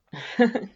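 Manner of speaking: a bright amused chuckle; short laugh. Acted. Laughing at being called a princess, amused. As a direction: laugh off the question. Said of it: "You have to laugh at that".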